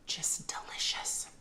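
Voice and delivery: breathy whisper of delight. Real, not acted. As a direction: whisper it, savouring the taste. Savouring food on camera.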